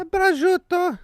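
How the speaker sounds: loud, high Italian-American mobster yell; mock Italian-American accent. Co-host listing deli meats, hammed-up Italian-American shout.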